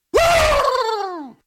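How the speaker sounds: high, sustained "woo!" shout; excited whoop. Real, not acted. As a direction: let out a long, high preacher's whoop. Preacher whoops mid-sermon.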